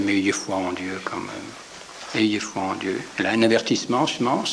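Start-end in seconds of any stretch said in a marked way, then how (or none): 0.0-3.0 slow, soft and lower, a calm master's reply; gentle Jesus voice. A narrator's reading. Jesus answering Peter, soft and slow. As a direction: say it slowly and gently, reassuring. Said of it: "Et notre Seigneur dit".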